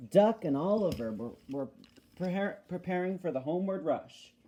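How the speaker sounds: ordinary delivery, nothing marked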